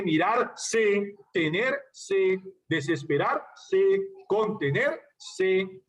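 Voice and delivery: slow "mirarse", with a pause before the "se"; final -se split off. Shown as a demonstration. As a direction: break each word before "se", as in "tener... se". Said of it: "en todas ese último C".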